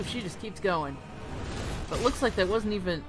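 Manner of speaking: ordinary talk, nothing marked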